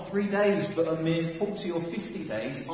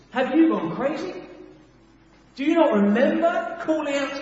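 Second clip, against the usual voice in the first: incredulous rebuke; 5 semitones higher, 5 dB louder than usual. Acted. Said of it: "enter into the story as a kind of narrator".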